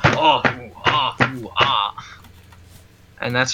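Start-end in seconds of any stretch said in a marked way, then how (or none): 0.0-2.0 low mock stomping groans; stomping grunts. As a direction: grunt like clomping around. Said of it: "I'm not just, like, going".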